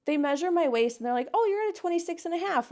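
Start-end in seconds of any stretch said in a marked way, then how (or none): 1.3-2.7 high, fast, breezy official voice; bright casual clerk. Retold or imitated. The woman measuring her waist, breezy and high. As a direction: toss it off cheerily.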